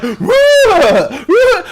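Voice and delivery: imitating her trunk sound; mock elephant trunk call. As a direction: hoot while swinging a trunk. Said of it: "She's like".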